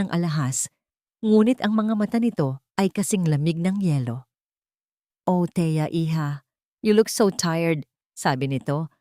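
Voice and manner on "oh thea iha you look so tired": sweet but mocking. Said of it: "Ang boses ay matamis, ngunit may pahiwatig ng pangungutya".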